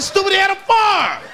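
high, slow laughing shout; mock shout. Comic answering toothless Leon in class, high laughing shout. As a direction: bark the answer at the dumb classmate.

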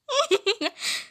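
high giggling fit; uncontrollable giggle. Pretend. Unable to stop after being told off, high giggle. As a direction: giggle helplessly. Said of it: "I can't stop laughing".

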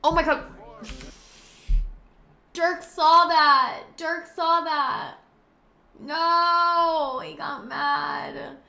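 high, loud and slow, wailing in horror; horrified shout. Real, not acted. Host sees her partner catch her kissing Santa, horrified wail. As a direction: cry out in horror. Dirk saw the kiss, shouts.

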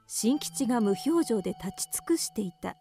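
ordinary talk

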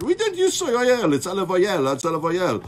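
mock French accent, high and loud; playful French waiter accent. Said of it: "beautiful French accent".

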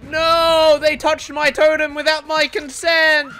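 loud, high, drawn-out mock protest; mock outrage. Gamer protesting that his totem was touched, mock outrage. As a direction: wail in hammed-up outrage.

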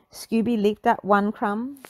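ordinary delivery

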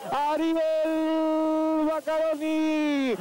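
high, very slow stretched words; drawn-out goal call. Real, not acted. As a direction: stretch every syllable. Goal announcement.

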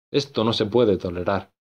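plain reading voice

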